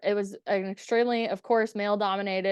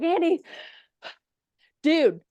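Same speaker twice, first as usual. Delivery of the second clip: higher, louder retold call, urgent; excited calling out; 8 semitones higher, 4 dB louder than usual. Running into coworker's office with an idea, excited calling. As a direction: burst in and grab a friend's attention.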